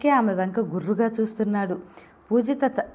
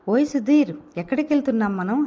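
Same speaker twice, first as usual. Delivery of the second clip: ordinary delivery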